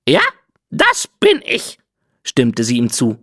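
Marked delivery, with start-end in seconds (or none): 0.1-1.8 a parrot basking in praise; smug parrot. Acted. Parrot agrees smugly.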